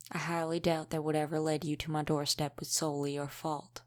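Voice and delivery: ordinary talk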